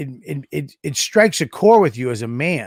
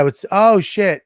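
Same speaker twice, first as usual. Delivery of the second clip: higher, louder "oh shit" of recognition; surprised recognition; 6 semitones higher, 4 dB louder than usual. Retold or imitated. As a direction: imitate a cop suddenly recognizing someone. Acted cop recognizing a famous driver.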